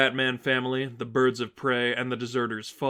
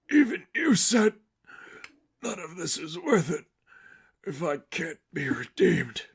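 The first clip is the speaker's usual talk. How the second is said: a hint of a choking, battered man; strained broken voice; 5 semitones higher than usual. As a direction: struggle the words out through a broken face. Seraphim quoting, struggling to speak.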